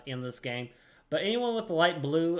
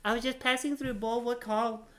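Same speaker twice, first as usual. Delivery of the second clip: higher, sailor-ish put-on accent for Ninya; playful accent reading an adventurer's lines; 7 semitones higher than usual. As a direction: voice the NPC with a made-up salty accent. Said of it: "she doesn't actually have that kind of accent".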